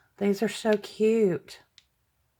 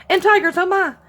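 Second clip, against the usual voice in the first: excited squeal; 9 semitones higher, 6 dB louder, much faster than usual. Real, not acted. Gushing over sticker gifts.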